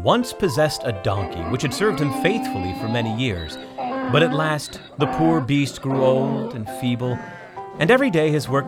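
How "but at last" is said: usual speaking voice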